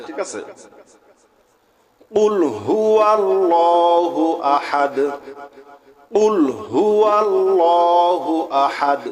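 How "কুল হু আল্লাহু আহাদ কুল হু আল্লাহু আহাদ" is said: slow, drawn-out chanted verse; slow recitation. Shown as a demonstration. Teacher models the verse slowly for students, chanted. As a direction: intone it slowly, stopping on Ahad. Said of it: "থামলে হবে আহাদ".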